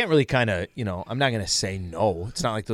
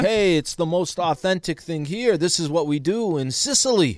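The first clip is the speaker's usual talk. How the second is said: mock Italian accent; 6 semitones higher than usual. Co-host mocking an Olive Garden ad, lightly hammed-up Italian accent. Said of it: "It was this like".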